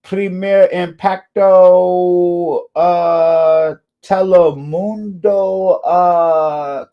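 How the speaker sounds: high, loud, drawn-out Spanish TV announcer voice; booming Spanish-language TV host impression; Telemundo announcer. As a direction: stretch each word like a Telemundo promo. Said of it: "you always hear the".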